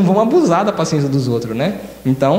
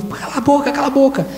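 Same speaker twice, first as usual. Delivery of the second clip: higher, snappy hush; mock shushing crowd; 7 semitones higher than usual. Crowd telling the shouting blind man to shut up, higher snappy hush. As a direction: tell him to shut up.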